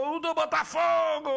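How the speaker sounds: high ringing goal call; goal shout. Real, not acted.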